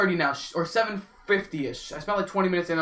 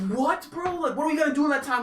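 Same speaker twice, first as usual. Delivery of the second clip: exasperated; 9 semitones higher than usual. Retold or imitated. The long wait.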